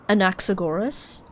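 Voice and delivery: Anaxagoras with the punch on "nax"; stress on second syllable. Shown as a demonstration. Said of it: "put stress on the N A there".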